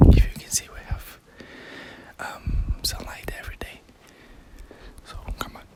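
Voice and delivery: hushed whisper throughout; library whisper. Real, not acted. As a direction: whisper so as not to disturb readers. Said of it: "I will start to speak a little bit quiet".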